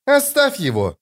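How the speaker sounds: high, urgent command; sharp order. A narrator's reading. Corporal stopping a fight, ordering sharply. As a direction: order her off him, pitched right up. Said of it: "приказала Хватка".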